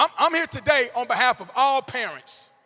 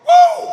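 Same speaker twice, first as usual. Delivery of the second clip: high loud whoop at the men; excited whoop; 17 dB louder than usual. Real, not acted. Speaker whooping at the men, sudden loud whoop. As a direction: whoop out to the few men in the room.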